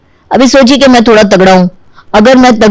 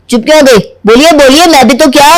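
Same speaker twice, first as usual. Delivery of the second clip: higher-pitched, insistent demand; indignant challenge; 5 semitones higher than usual. Acted. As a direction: press her to finish the insult. Presses her to finish insult.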